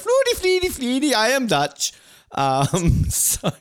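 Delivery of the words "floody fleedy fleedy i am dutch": very high, elfin fake-Dutch babble; hammed-up Dutch as a mythical elf language; mock Dutch. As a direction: tease with a squeaky pretend Dutch accent. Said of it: "it sounded like some mythical elf language".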